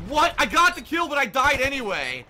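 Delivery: high, loud protest; real exasperated disbelief; indignant yell. Real, not acted. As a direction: shout it as an outraged complaint. Protests unfair death.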